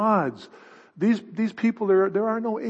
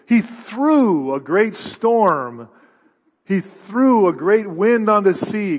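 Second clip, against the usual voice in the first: threw slow and louder; stress on threw; 3 semitones higher, 5 dB louder, slower than usual. Shown as a demonstration. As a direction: hit threw hard, slowly. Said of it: "this is an active word".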